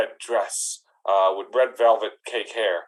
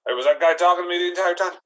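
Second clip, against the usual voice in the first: surprised gruff dwarf; 7 semitones higher, 4 dB louder, faster than usual. Acted. Gimli finally speaks.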